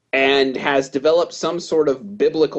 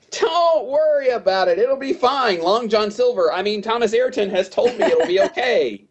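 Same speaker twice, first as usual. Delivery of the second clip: much higher put-on voice, breezy and unbothered; hammed-up higher voice of the trusting lord; carefree lordship; 9 semitones higher than usual. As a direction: play the gullible lord pitched way up, waving off worry. Voicing carefree John and his lordship.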